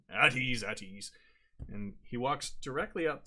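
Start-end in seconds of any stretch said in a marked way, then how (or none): 0.1-0.6 barked order, much louder; loud command. Acted. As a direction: snap the guards out of attention. Captain commands the guards.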